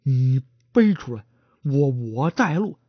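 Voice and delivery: stammering, tripping over every word; a nervous stutterer begging to lead the way. Acted. 结巴的伙计结结巴巴地求着带路. Stuttering porter voiced in story.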